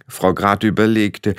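usual speaking voice

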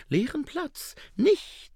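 "Leeren Platz?" high, rising with sudden glee; a salesman who smells a deal, "Platz" lifted up; delighted huckster. A narrator's reading. Schnapper delighted. ("Leeren Platz?" hoch und freudig steigend)

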